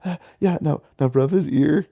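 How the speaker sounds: high-pitched imitation of an overkeen instructor; crazy kindergarten teacher. Instructor coaching puppy Lamaze, mock kindergarten-teacher voice. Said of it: "The crazy kindergarten teacher".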